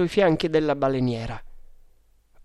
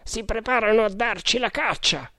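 cried warning; 8 semitones higher than usual. A narrator's reading. One of the Tugs warning of pursuit, contained high cry. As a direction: warn the boat with a lifted pitch. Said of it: "gridò uno dei Tugs".

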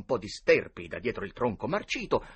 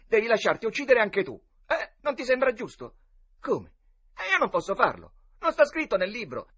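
discussione tra ragazzi; 11 semitones higher, 6 dB louder than usual. Acted. Boys argue roles.

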